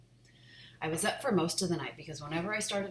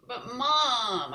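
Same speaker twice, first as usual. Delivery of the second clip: pleading; 8 semitones higher, 8 dB louder than usual. Acted. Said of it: "I started to plead".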